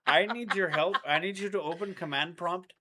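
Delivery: mock Indian scam caller. Boyfriend playing a scam tech support caller in a mock Indian accent. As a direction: play a pushy fake support agent. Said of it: "Microsoft Tech Support".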